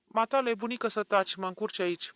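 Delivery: loud rebuke. A narrator's reading. Doctor silencing the grandmother, loud and sharp. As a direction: cut the old woman off loudly. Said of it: "am adăugat cu glas tare".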